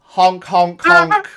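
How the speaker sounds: loud high honk honk honk; honking clown horn. Acted. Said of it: "he actually honked".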